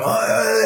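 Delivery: high drawn-out mock "ah"; exaggerated high wail; mock groan. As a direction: imitate an overblown groan. Mimics the other man's reaction.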